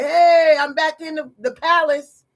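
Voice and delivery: loud, high mock cheer; celebrating. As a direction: shout it as the returning man, triumphant. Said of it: "He celebrate".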